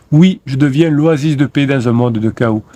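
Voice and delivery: usual speaking voice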